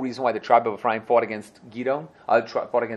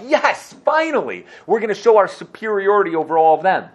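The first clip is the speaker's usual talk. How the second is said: higher, louder gleeful yes; eager cheer; 7 semitones higher, 6 dB louder than usual. Acted. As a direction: cheer like oppressed men finally unleashed. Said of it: "they're like".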